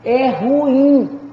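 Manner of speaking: "ruim" split as ru-IM, weight on "im"; stress on final syllable. Shown as a demonstration. Teacher correcting students' stress, emphatic "im" in "ruim". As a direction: lean firmly on the end of "ruim". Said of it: "Isso aqui é uma palavra oxítona".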